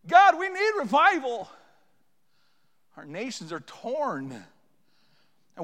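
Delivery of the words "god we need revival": loud, high, driving confession; fervent, relentless pulpit delivery; impassioned preaching. Real, not acted. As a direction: preach with mounting urgency to a cry. Preaches at full force.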